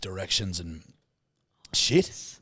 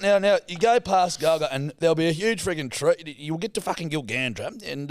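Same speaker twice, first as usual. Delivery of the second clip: imitating dad; 7 semitones higher, 3 dB louder than usual. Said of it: "He's going".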